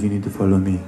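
put-on French accent. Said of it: "in a broken French accent".

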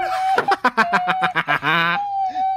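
loud high laugh. Real, not acted. Player bursting out laughing, loud and high.

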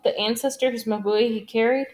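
plain reading voice

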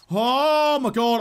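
slow, drawn-out loud gasp of alarm; alarmed cry. Real, not acted. Streamer realising time is short, drawn-out alarmed cry. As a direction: stretch it out in real alarm.

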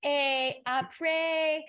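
high, loud, drawn-out bad French accent; terrible French accent. Girl reading her essay to French class, in a terrible French accent. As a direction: read French aloud in a mock terrible accent. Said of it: "with the worst French accent imaginable".

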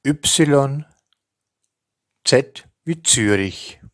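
each letter and name spoken slowly and distinctly with pauses; careful spelling dictation. Shown as a demonstration. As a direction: dictate it clearly, like spelling over the phone. Teacher reads Austrian alphabet.

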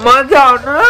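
high, whiny mock begging; playful pleading. Playfully begging child not to leave.

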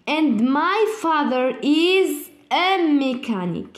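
ordinary talk

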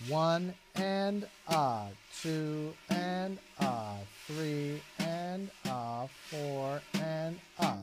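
drawn-out triplet count, even and steady; slow counting aloud. Shown as a demonstration. Teacher counting triplets slowly for the student. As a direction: count each beat out slowly while playing.

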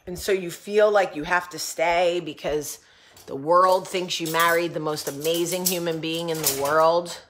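ordinary talk, nothing marked

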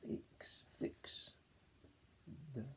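a soft whispered fix; whispered sound-out. Shown as a demonstration. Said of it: "let's try and read it together".

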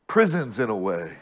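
usual speaking voice

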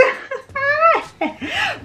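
very high squealing laugh; playful laugh. Real, not acted. Vlogger laughing after the bonnet plug, high playful laugh.

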